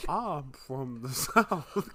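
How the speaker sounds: slow, drawn-out Southern drawl; playful, exaggerated Southern twang, very slow; Southern accent. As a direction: stretch it into a hammed-up Southern accent. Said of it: "Can I do a Southern Emil accent?".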